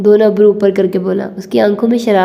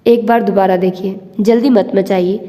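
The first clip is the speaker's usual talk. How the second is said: ordinary delivery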